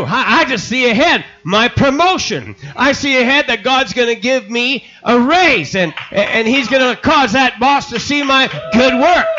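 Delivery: high, loud, triumphant proclamation; excited shout. Acted. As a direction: shout your coming promotion like it's already yours. Man shouting his faith vision.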